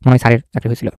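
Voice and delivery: ordinary talk, nothing marked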